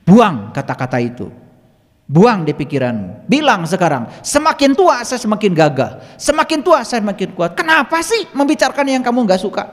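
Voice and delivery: re-enacted scolding, climbing to a high pitch. Healer ordering a patient to drop negative words, scolding. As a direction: lay into them, building from firm orders to high exasperation. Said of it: "Saya omelin".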